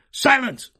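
high, loud enchanter's shout; shouted command. A narrator's reading. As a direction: shout the room into silence. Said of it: "shouted the enchanter again".

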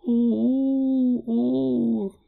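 put-on high, drawn-out child's "oh"; terrified little girl wailing out; crying wail. A narrator's reading. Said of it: "her voice cried, getting louder".

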